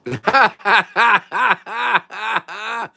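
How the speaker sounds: long high laugh in steady bursts; helpless LOL laugh; real laughter. Real, not acted. Laughs at a viewer's joke.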